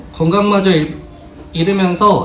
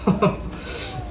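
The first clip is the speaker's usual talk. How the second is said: soft breathy laugh; 8 dB quieter than usual. Real, not acted. Laughs after saying it's sad.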